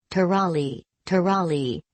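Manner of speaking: plain reading voice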